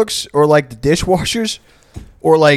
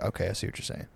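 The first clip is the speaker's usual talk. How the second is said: resigned mumble; 6 semitones lower, 18 dB quieter, faster than usual. Retold or imitated. Giving in to the manager.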